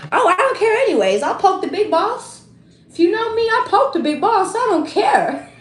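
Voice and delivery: a cheeky boast, giggling at the end; playful bragging. Real, not acted. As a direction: tease like you're proud of poking the boss. Bragging she pokes the big boss.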